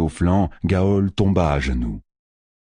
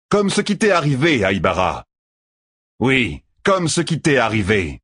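shouting; 9 semitones higher than usual. Acted. Tueur boasts to Perrine at a high shout. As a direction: boast at full voice. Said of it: "cria Tueur".

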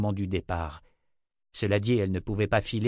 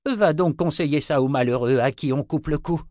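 bitter retort; 6 semitones higher, 6 dB louder than usual. Acted. Zed retorts bitterly to Richard.

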